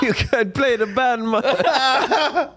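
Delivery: high laughing Jamaican voice; mock Jamaican boss, laughing; Jamaican accent. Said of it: "with a Jamaican accent".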